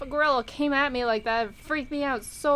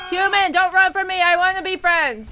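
high, loud plea to a runaway; calling out; 7 semitones higher, 7 dB louder than usual. Pretend. Gamer gorilla to fleeing human, loud pleading call. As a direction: shout after someone fleeing.

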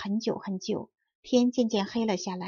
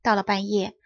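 usual speaking voice

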